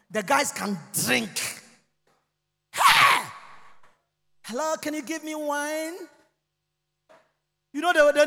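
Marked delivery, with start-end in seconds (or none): 2.7-3.2 high sharp call; sudden call. Acted. Calling out on the plane. 4.4-5.9 lightly voiced passenger, quieter; a soft mimic of the drinker; passenger asking for wine. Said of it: "Ivorians asking for wine".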